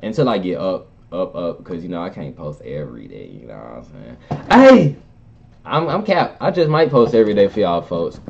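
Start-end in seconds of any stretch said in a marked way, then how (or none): 4.5-5.0 high, loud intro yell; hype shout. Acted. Vlogger opens his shoutout to fans, loud yell. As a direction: shout it to open the clip.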